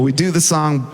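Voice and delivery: ordinary delivery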